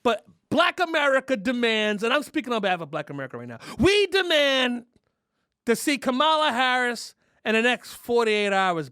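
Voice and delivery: loud, high, stretched demand; rally the crowd behind it; mock rally demand.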